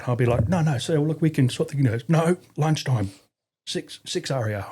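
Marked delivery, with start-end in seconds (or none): 0.5-1.8 high, hurried plea to the boss; pleading corporal. Retold or imitated. 2.1-4.7 slow, firm insistence; no arguing, just repeat the order; firm RSM. Retold or imitated.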